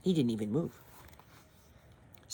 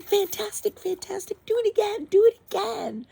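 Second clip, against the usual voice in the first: very high, loud cheering shout; 8 dB louder than usual. A narrator's reading. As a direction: egg him on, screaming with delight. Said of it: "Elizabeth shouted".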